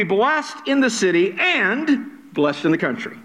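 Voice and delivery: "and" drawn out and set apart; stress on "and". Shown as a demonstration. Preacher reads blessings to congregation, stressing "and". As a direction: lean hard on "and", slowly. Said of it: "I'm gonna be emphasizing the word "and".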